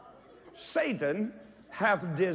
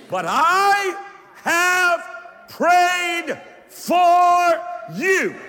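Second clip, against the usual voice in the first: very high, loud, hugely drawn-out words; climactic preacher's shout; 13 semitones higher, 11 dB louder, much slower than usual. Real, not acted. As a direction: hold each word long at full cry.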